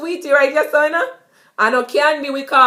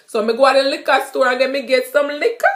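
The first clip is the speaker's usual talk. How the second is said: fast Jamaican patois, higher and louder; 5 semitones higher, 4 dB louder, faster than usual. Shown as a demonstration. Teacher giving a patois liquor store example. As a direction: rattle it off Jamaican style. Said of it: "I went to the liquor store".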